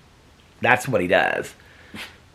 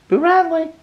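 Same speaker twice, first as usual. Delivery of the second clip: much higher calling voice; pet talk; 6 dB louder than usual. Real, not acted. Owner calling her dog Boo Radley, high and fond. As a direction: sing his name up high to the dog.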